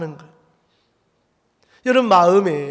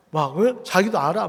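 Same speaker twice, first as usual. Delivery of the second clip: ordinary talk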